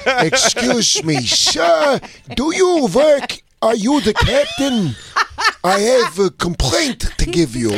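high fussy complaining passenger; whiny complainer voice. Acted. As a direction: fuss at the captain politely. Complaining to captain.

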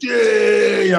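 hyped-up shout, voice jumping high; pumped fighter's wild yell. Retold or imitated. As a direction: whoop it out like you're going wild. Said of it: "I'm being wild and weird".

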